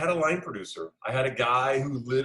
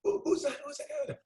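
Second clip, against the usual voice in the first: squeaky high startled question; mock alarmed who is that; faster than usual. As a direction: shoot way up in pitch, alarmed. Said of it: "calls the key grip over".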